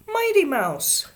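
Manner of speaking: high, loud, drawn-out boast; triumphant crow. A narrator's reading. Davy delighted he baffled Ramona, crowing. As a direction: crow it proudly like a delighted child. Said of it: "crowed Davy".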